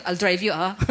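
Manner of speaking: a stranger's ride, said as a local would with a laugh; mock suspicious offer. Pastor jokes how a local stranger would offer, laughing. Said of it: "Pag ano dito yun".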